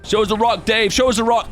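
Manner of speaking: lightly voiced pestering fans; impatient viewers. Vlogger voicing viewers demanding to see the rock.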